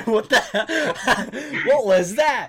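high, loud, through laughter; real laughing disbelief. Real, not acted. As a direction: crack up while demanding an explanation. Laughing in disbelief at friend's noise.

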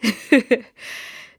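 high giggling laugh; giddy laugh. Real, not acted. After confessing love for boyfriend, giddy laugh.

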